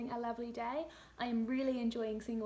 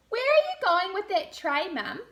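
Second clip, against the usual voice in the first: put-on high, loud little girl asking; child's voice; 8 semitones higher, 11 dB louder than usual. Laura quizzing her mum about the tray, high and loud child's voice. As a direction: squeak it out like a curious small girl. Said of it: "asked Laura".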